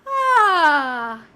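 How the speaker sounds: loud open sighing ah on the exhale; big sigh. Shown as a demonstration. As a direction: release a long, loud sigh. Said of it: "exhale on a sigh".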